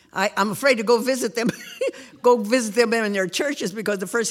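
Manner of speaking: talking through real laughter, quickened; laughing. Real, not acted. Grandmother laughing about being called up in church, laughing. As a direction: giggle the words out fast.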